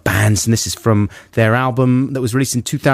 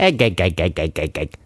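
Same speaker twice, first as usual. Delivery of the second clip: Popeye; 6 semitones lower, 3 dB quieter than usual. Acted. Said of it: "What does Popeye have for breakfast".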